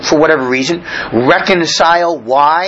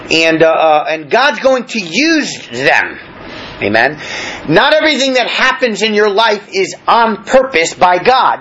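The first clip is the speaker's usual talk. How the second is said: normal talking voice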